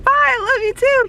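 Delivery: very high squealed farewell; retold giddy high-pitched bye; fangirl goodbye. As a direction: squeal goodbye to your idol.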